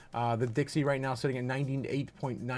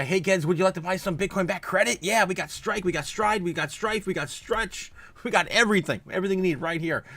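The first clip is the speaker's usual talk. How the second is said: hammed-up pitchman voice, higher; mock salesman pitch; 6 semitones higher than usual. Streamer hawking credit products to viewers, playful pitchman voice. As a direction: sell it to the kids like a carnival barker.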